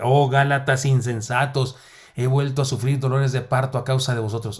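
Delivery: un lamento apasionado, más fuerte y lento; lectura dramática. Shown as a demonstration. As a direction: clama como el apóstol dolido. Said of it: "la entonación es más". (lectura dramática y apasionada de Gálatas)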